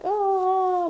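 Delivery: high, strained moan imitating a sleeper's nightmare. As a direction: mimic a sleeper groaning high in a bad dream. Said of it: "like, making noises".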